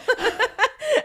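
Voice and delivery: rising high-pitched laugh. Real, not acted. Guest laughing about being her skinniest, high laugh. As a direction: laugh at the irony.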